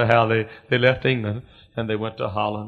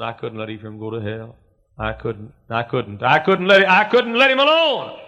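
anguished relenting, building; 4 dB louder than usual. Acted. The Lord relenting, building to cry.

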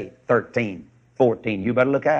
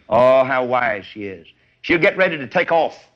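normal talking voice

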